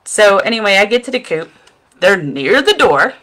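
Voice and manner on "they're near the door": ordinary talk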